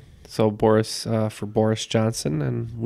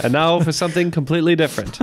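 announcer impression; 5 semitones higher, 5 dB louder, faster than usual. Quoting the Monty Python catchphrase.

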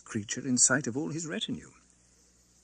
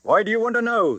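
1 retort; 11 semitones higher, 6 dB louder than usual. A narrator's reading. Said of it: "the guard retorted".

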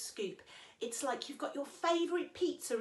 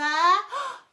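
dramatic gasp; 8 semitones higher, 11 dB louder than usual. Acted. Dramatic gasp before reveal.

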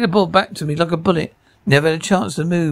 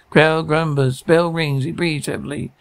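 ordinary talk, nothing marked

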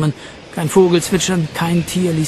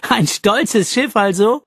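higher, lilting amused exclamation; 7 semitones higher, slower than usual. A narrator's reading. Jean laughs at Jack's proud ship, amused. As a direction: tease with a laugh behind it. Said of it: "Jean musste wieder lachen".

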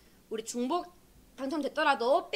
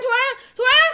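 louder high calling; excited; 8 semitones higher, 10 dB louder, faster than usual. Real, not acted. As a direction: build the suspense. Wheel slowing on names.

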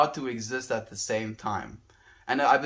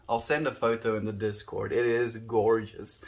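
normal talking voice

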